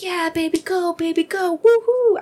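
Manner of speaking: high cheer building to a loud woohoo; little cheerleader. Retold or imitated. Imagined cheering for her husband, high and building. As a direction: bounce it like a pom-pom cheer. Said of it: "a little cheerleader".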